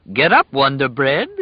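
loud gleeful call to the prisoner. A narrator's reading. Jailer calls into the cell, gleeful and loud. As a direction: call it in with glee. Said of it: "a gleeful voice called in".

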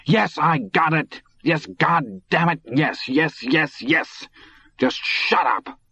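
high, strained, exasperated screaming without much volume. A narrator's reading. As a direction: scream each word, drawing it out. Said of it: "Todd screamed".

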